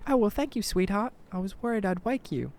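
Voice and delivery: put-on high-pitched English old-lady voice; sweet English granny, pitched high. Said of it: "She's originally from England".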